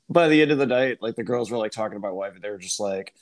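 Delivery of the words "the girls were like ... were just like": usual speaking voice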